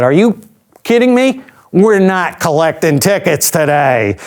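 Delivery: put-on high, incredulous New York accent; mock New York yelling. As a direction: scold a traveler in an exaggerated New York accent. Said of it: "she would yell at me".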